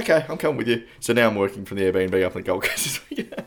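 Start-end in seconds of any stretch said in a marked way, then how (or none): 0.1-0.8 high, loud keen announcement; eager. Retold or imitated. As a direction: announce it, sold on the trip. Retelling sudden decision to join.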